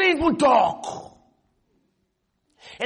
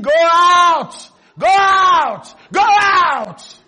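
loud high chant; class screaming; 6 semitones higher, 11 dB louder, much slower than usual. Retold or imitated. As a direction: shout it like the whole class. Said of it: "started screaming at him".

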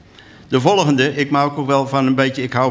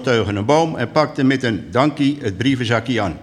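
ordinary delivery

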